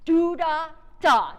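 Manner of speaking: loud "Do" leaning on the first eighth note; weight on first note. Shown as a demonstration. Conductor showing the band where the weight goes, "Do" leaned on. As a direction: lean hard into "Do". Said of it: "put W E I G H T on that note".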